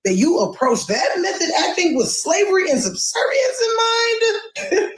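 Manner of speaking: rising into a high-pitched, disbelieving question; incredulous. Real, not acted.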